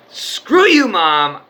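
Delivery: a high, loud mock shout at his mother; retold yell. Son shouting at his mom while leaving, loud and high. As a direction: fling it at her as you storm out.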